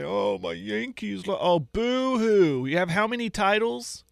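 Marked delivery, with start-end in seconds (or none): 0.0-2.6 whiny mock sobbing, slow; hammed-up crybaby fan whimper; fake crying. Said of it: "start texting me and say".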